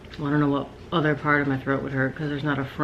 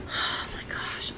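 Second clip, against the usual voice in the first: exasperated whisper; 12 dB quieter, much slower than usual. Real, not acted. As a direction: breathe it out under the breath. Exasperated aside.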